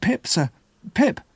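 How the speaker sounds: put-on high child's voice, quick and timid; scared boy answering. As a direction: answer fast to a frightening stranger. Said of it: "I answered".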